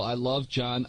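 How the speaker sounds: ordinary delivery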